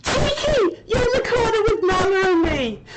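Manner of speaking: high, loud gleeful shout; excited call-out. Real, not acted. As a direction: call her over with glee. Calling friend into the corner.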